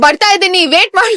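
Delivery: high, loud bluffed retort; mock yell back. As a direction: call back impatiently in pretend-fluent Kannada. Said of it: "I would yell back".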